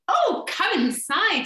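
high excited welcome; eager troll voices. Acted.

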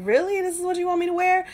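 high, disbelieving question to God; stunned, half-laughing protest; incredulous. Retold or imitated. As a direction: pitch it up in mock disbelief. Arguing with God about a dress.

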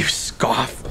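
hissed accusation, almost voiceless; hushed retort. Acted. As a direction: drop to a bitter whisper. Retort to mocking remark.

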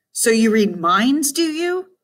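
slow, high mocking sing-song. A narrator's reading. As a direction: tease in a lilting tune. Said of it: "A sing-song voice".

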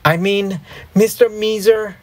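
high, flustered self-correction; hurriedly fix the slip, pitched high; crying out a correction. A narrator's reading. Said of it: "I cried".

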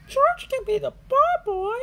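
put-on high, loud girl cheer; cheering Susie Sheep. A narrator's reading. Susie suggests George be ball boy, cheering high. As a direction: announce the idea brightly. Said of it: "cheers Susie".

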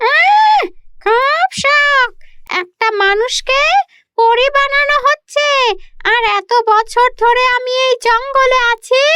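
high, sneering witch voice with a cackle; wicked witch. Acted. (হিংসুটে ডাইনির উঁচু কর্কশ গলা, অট্টহাসি)

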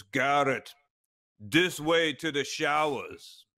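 slow, imitated German accent for the astronaut; mock German astronaut voice, drawn out. German classmate pointing the way to the showers, mock German accent. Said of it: "in that accent".

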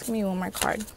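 ordinary talk

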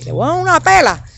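half-joking mother's threat; mock scolding threat. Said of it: "nosotros hablamos así". (amenaza de madre en tono agudo, sin subir la voz)

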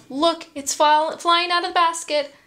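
high, hurried storyteller's alarm; mock alarm. A narrator's reading. Storyteller showing the egg flying out, high mock alarm. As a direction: cry out in quick, high alarm.

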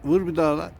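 high, loud urging cry; excited shout. Real, not acted. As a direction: yell at the bird to hit it again. Urging the bird on.

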